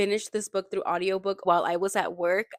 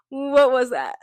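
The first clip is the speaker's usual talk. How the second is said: higher and louder in shock; stunned outburst; 5 semitones higher, 8 dB louder, slower than usual. Real, not acted. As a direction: blurt it out, floored by the twist. Reacts to the plot twist.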